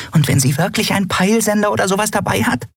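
hoch und schnell, aufgeregtes kleines Wesen; nervös drauflosplappern. A narrator's reading. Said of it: "flatterte aufgeregt". (hohe, schnelle, aufgeregte Stimme)

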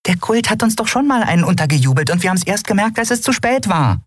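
hoch und schnell, aufgeregtes kleines Wesen; nervös drauflosplappern. A narrator's reading. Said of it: "flatterte aufgeregt". (hohe, schnelle, aufgeregte Stimme)

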